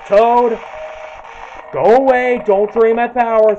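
loud frantic shout; yelling. Real, not acted. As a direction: yell it out in a panic. Yelling at enemy in game.

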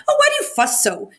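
high, sharp cross snap; cross robber girl, pitched far up; snapped crossly. A narrator's reading. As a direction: snap it at the reindeer. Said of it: "she snapped crossly".

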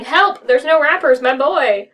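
hammed-up yell for help; frantic yelling. Mother pounding on the detective's office door, yelling. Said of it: "when she came pounding on my office door yelling".

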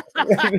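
light burst of laughter; short real laugh. Real, not acted. Laughing at the yawn.

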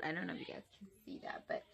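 whisper. Real, not acted. Whispered aside.

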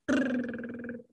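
soft lip trill blown out like a horse. Shown as a demonstration. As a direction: flap your lips in a quiet brrr. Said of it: "doing kind of horse lips".

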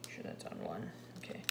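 whispering to herself; under-the-breath whisper; whispered aside. Real, not acted. Muttering to herself while working.

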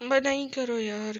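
loud, high yell of protest; exasperated, pleading shout; protesting cry. Real, not acted. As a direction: cry out for it to stop. Being attacked while climbing.

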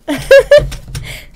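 high, loud laugh close to crying; tearful laugh. Real, not acted. Streamer about to cry again, tearful laugh. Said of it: "I'm gonna cry again".

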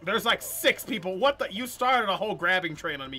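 outraged protest. Real, not acted. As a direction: protest loudly at being ganged up on. Streamer ganged up on in game.

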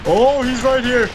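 high, loud sudden warning; alarm shout. Real, not acted. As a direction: call out the enemy. Enemy spotted nearby.

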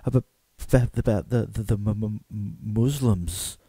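hesitant, tongue-tied stammering; nervous stutter. Acted. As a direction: stumble haltingly towards the difficult word. Flustered man stammering out his question.

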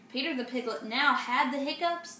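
usual speaking voice

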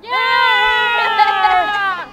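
loud excited whoop; cheering shout. Real, not acted. Crowd cheer after speech.

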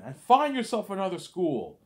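high, loud screaming rage; father disowning the boy, screaming high and loud; furious dad. Retold or imitated. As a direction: scream it down at the son like a raging father.

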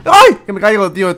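sudden high yelp of fright; screaming while falling. Real, not acted. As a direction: yelp like you're slipping off an edge. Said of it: "Tenía miedo".

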